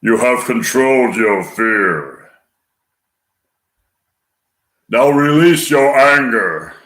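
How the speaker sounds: slow, muffled, sinister villain voice; drawn-out, muffled dark-lord delivery; Darth Vader. Acted. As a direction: intone it with slow menace, as through a mask. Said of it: "Darth Vader in a muffled, sinister voice, breathing heavily".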